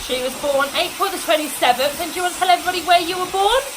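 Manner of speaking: over hair dryer. Real, not acted. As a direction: talk loudly over the dryer. Talking over running hair dryer.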